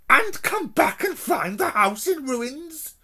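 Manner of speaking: a scornful woman's snarl. Acted. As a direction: spit out the worry with contempt. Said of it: "she snarled".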